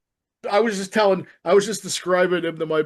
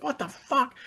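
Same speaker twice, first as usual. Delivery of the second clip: high, softened mock outburst; retold disbelief; 8 semitones higher, 5 dB quieter than usual. Retelling his reaction to the movie, high hushed disbelief.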